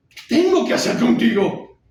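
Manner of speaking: desperate high-pitched shout; loud, enraged cry of helplessness; irate. Acted. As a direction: explode at your father at full volume. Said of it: "él responde iracundo".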